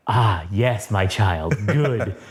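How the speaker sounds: lightly voiced low mentor villain; sinister mentor. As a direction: savour it like a mock sinister master. Villain's lines acted in comic retelling.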